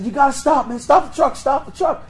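high, loud re-enacted urgency; urgent calling out. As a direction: call to the driver to halt right now. Retelling urgent order to stop truck.

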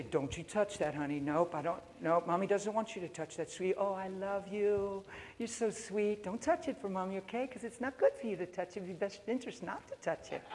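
soft, coaxing mommy voice; gentle mother. Acted. Mother to toddler who must not touch, gently coaxing. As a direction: act out sweetly coaxing a little one.

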